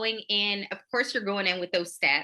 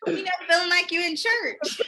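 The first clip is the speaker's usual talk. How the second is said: laughing; 10 semitones higher, 3 dB louder than usual. Real, not acted. Co-host laughing while quoting.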